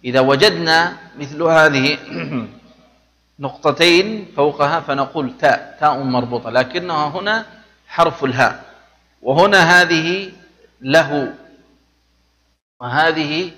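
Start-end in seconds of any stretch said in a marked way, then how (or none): none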